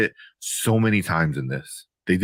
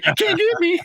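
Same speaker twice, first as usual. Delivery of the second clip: high, playful getaway taunt; cartoonish taunt, pitched way up; mock taunt. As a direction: tease them in a high mock voice. Imitating character taunting while running away.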